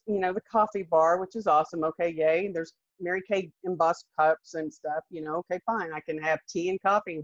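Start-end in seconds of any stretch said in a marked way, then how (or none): none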